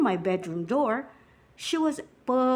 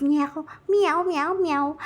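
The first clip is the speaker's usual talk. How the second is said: light meows jumping higher after the first; imitated cat; 4 semitones higher, slower than usual. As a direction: mimic a cat's repeated mewing. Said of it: "My cat says".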